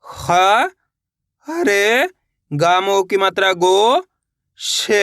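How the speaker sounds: isolated sounds with pauses, "गो" drawn out and rising; syllable-by-syllable spelling. Shown as a demonstration. Teacher leading learners to repeat along, slowly spelled out. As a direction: sound out "ख", "हरे", "गो", "श" one at a time. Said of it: "मेरे साथ साथ इनको repeat करना है".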